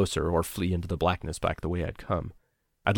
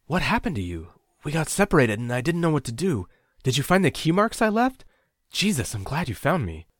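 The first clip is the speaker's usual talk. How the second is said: higher, louder tumbling questions; anxious rush; 9 semitones higher, 5 dB louder than usual. Acted. Reunited narrator pours questions at lost friend, anxious and louder. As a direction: spill it all out in relief.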